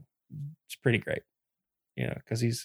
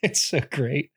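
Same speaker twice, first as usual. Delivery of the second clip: ordinary talk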